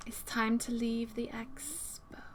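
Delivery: slow, unvoiced whisper. Real, not acted. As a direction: whisper it slowly as if sneaking out. Sneaking out of the expo.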